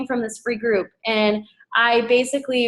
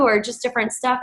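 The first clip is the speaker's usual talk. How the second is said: ordinary talk, nothing marked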